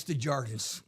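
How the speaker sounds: Desjardins as English deh-ZHAR-dinz; anglicized name. Retold or imitated. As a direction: say Desjardins with the final S sounded. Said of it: "I don't speak French".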